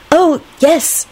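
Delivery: put-on high, eager accented reply; enthusiastic. A narrator's reading. Lady answers the young man, enthusiastically. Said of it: "Cosmina replied enthusiastically".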